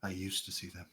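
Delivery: put-on quiet, hesitant old stranger; soft blind stranger. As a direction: speak softly like a wary blind man. NPC quietly recalls.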